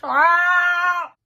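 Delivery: loud, high scream of annoyance; frustrated scream. Real, not acted. Vlogger fed up with her ugly look, frustrated scream. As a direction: let out a long, exasperated "aah". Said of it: "Je suis trop énervée".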